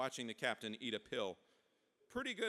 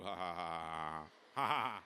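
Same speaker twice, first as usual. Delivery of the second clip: voiced mock laugh, dropping in pitch; teasing fake laugh; 9 semitones lower than usual. Sacagawea laughs at her joke.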